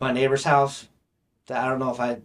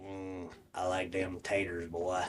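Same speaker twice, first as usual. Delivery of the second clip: Billy Bob Thornton; 7 semitones lower, 13 dB quieter, slower than usual. Acted. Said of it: "Ray talks like this".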